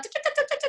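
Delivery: very high mock nagging noise; nagging chatter noise. A mother scolding all morning, as a high nagging chatter. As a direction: chatter it fast and shrill like endless scolding.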